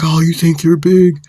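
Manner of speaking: retold confrontational jab; taunting challenge. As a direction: act out a loud challenge. Acted challenge in quarrel.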